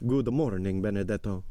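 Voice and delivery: lightly voiced hollow-toned steward; a hint of a deep, hollow character voice. A narrator's reading. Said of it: "said Bertuccio with his deep, hollow voice".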